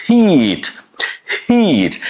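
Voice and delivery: "heat" with a long E. Shown as a demonstration. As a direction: push the H and stretch the ee in "heat". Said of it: "you open the corner of your lips".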